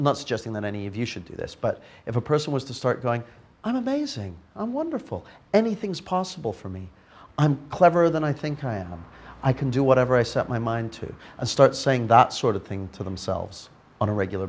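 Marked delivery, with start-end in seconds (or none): 3.6-11.1 much higher, hammed-up gushing, settling back down; mock over-the-top cheerleader for yourself; bright mock affirmation fading to normal; gushing self-talk. Mocks upbeat positive self-talk.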